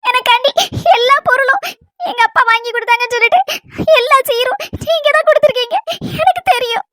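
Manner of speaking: tearful, halting confession; weepy and choked, pausing often; crying. Acted. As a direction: speak in broken phrases between sobs. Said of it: "நீ முதல்ல அழுகையை நிறுத்து".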